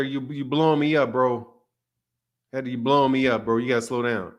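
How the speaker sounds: ordinary delivery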